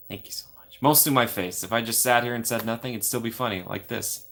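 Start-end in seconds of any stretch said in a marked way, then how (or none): 0.1-0.8 soft breathy whisper; whispered grateful thanks. Real, not acted. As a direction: whisper it close to the mic.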